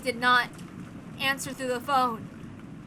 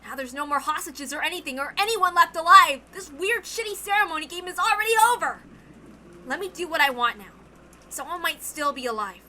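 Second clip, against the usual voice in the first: loud, furious ranting; angry outburst; 5 semitones higher, 7 dB louder than usual. Acted. Battler vows to find the killer, furious. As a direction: spit the words out in a rage.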